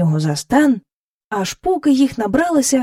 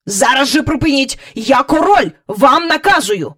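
loud, higher royal command; angry; 5 semitones higher, 4 dB louder than usual. A narrator's reading. King ordering the cow to stop at once, angry. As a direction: order it angrily and forcefully. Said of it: "розсердився король".

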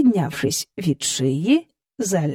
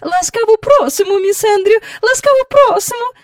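very high, loud, polite welcome; courteous hostess; 6 dB louder than usual. A narrator's reading. Mrs Banks welcoming Miss Andrew, courteous. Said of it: "чемно примовляла вона".

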